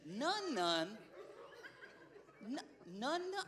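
soft, puzzled, half-laughing echo; bewildered repeat. Retold or imitated. Preacher echoing the child's word in quiet confusion. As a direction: repeat it like you can't make sense of it.

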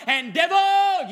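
sudden loud high cry of "devil"; shout at devil. Acted. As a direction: call out the devil with full force.

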